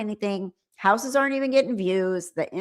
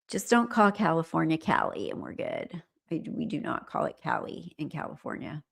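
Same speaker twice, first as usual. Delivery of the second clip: ordinary talk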